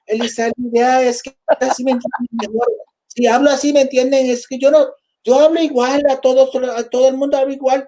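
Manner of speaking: put-on high, insistent copied Spanish accent; mom's borrowed dialect. Mother insisting she talks like everyone, high borrowed accent. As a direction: mimic Mom defending herself in a put-on dialect. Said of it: "that's not even how we talk".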